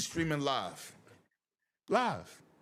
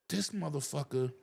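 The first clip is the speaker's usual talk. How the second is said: usual speaking voice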